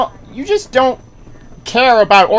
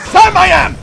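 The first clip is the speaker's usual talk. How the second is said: high, loud sudden outburst; shouted punchline; 9 semitones higher, 8 dB louder than usual. Pretend. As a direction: burst out loudly with the rhyme. Punchline after not liking this.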